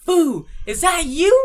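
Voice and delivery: a lightly voiced uncle asking; imitating uncle. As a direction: put on the uncle's slow question. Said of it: "and he goes".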